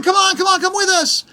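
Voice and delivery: high, hurried mock urging; imitating the guide rushing them along; urgent guide. Tour guide rushing the tired couple along, high mock urgency.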